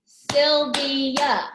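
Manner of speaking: SYLVIA in three loud, level beats; syllable by syllable. Shown as a demonstration. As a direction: clap out SYL, VI, A in SYLVIA. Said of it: "Sylvia has three".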